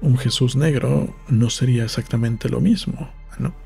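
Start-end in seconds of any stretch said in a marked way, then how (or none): none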